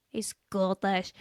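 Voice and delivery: put-on a quick, clumsy Scottish accent on "He's Scottish"; tossed-off Scottish accent that misses; bad Scottish accent. Mocking own attempt in a poor Scottish accent. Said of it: "will absolutely hate my accent there".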